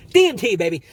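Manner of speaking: high, loud hype call; excited shout. Real, not acted. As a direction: announce it like a game show host. Hyped shout of drug name.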